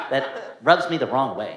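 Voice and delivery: ordinary delivery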